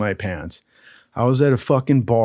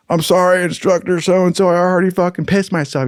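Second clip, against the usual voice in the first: high-pitched retold apology; pleading apology; 6 semitones higher, 3 dB louder than usual. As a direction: apologise in a raised, sheepish voice. Apologising to the instructor.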